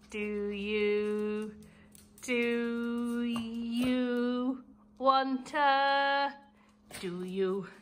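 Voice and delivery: slow, drawn-out teasing pet voice; dog-talk voice. Real, not acted. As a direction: tease the dog, stretching it out.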